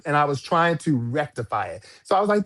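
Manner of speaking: usual speaking voice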